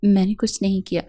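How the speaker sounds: a light tremble of fear in the denial; lightly voiced frightened girl, barely shaking; trembling voice. A narrator's reading. Roshane denies pushing Zoya to Abrar, mildly trembling. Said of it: "کانپتی ہوئی آواز میں کہا".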